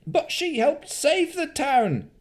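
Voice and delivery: high, slow call from the back; shouted protest. Acted. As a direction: defend her over the crowd. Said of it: "someone from the back of the crowd shouted".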